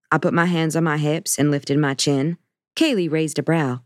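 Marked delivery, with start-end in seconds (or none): none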